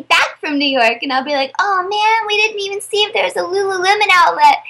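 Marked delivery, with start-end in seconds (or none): 1.6-4.6 higher, sudden oh-no realization; dismayed realization. Retold or imitated. On the drive back from New York to Stanley, higher dismayed realization. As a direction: remember too late, a bit deflated.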